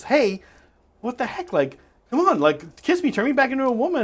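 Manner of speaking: high, exasperated pleading; acted pleading protest. Frog begging the engineer for a kiss, high exasperated plea. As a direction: plead as the put-upon talking frog.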